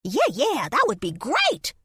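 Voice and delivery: high, loud, delighted chatter; gleeful squirrel. Acted. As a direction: chitter with glee. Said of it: "chittered with glee".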